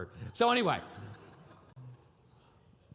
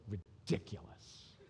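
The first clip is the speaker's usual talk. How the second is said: slow, softer, whispered scorn; contemptuous; 6 semitones lower, 12 dB quieter, slower than usual. Retold or imitated. Kid scorning his parent, whispered contempt. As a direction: drip with contempt under your breath. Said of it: "Oh, so much contempt".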